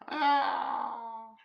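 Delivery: loud, high, drawn-out "ah"; hammed-up cry of despair; long wail of frustration; anguished wail. Real, not acted. Wails after the linker error.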